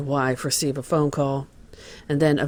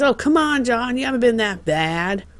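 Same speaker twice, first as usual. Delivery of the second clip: high, loud disbelieving reassurance; incredulous brush-off; 9 semitones higher, 4 dB louder than usual. Retold or imitated. To a friend confessing he has been bad, high loud disbelief. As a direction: laugh it off, much higher and louder.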